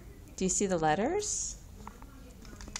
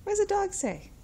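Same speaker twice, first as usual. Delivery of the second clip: asked again much higher, playful; high sing-song question; 13 semitones higher than usual. Shown as a demonstration. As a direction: lift it way up for the toddler.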